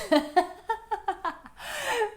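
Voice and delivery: real laugh up high; real laughter; high burst of laughter. Real, not acted. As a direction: break into a laugh. Laughing at her own joke.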